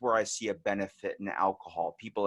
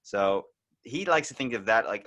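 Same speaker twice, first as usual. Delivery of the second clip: ordinary talk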